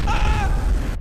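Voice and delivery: high loud shriek of alarm; startled scream. Real, not acted. Gamer ambushed by a mole, loud startled shriek. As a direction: let out a sudden panicked scream.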